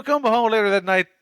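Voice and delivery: ordinary talk, nothing marked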